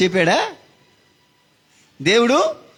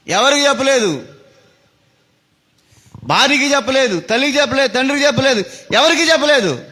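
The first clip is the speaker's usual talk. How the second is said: higher, driving sermon voice; raised preaching; 7 semitones higher than usual. Acted. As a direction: build the suspense for the congregation. (ఎత్తైన స్వరంలో ప్రసంగం)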